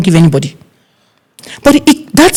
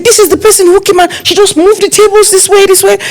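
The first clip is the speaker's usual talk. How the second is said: high, pointing voice; accusing staff girl; 14 semitones higher than usual. Retold or imitated. The staff girl accusing a guest to the planner, high voice. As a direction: tell on the culprit, voice pitched up. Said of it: "She said".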